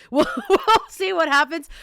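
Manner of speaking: laughing, high and loud. Real, not acted. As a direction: laugh through the words. Laughing while teasing.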